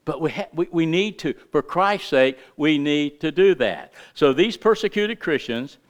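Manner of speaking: usual speaking voice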